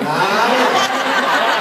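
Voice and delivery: high, loud laughing "Nah"; a flat-out laughing no; loud refusal. Retold or imitated. Comic refuses the clerk's joke envelope, loud laughing no.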